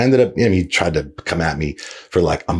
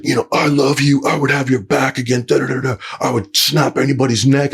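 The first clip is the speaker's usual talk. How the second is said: higher put-on voice, mocking the big guy's gushing loyalty; mocking his voice; 9 semitones higher than usual. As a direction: parrot him in a higher, put-on voice. Retold quote of the angry guy.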